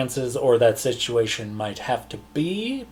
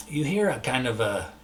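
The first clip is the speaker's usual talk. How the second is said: ordinary talk, nothing marked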